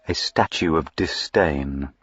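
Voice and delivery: plain reading voice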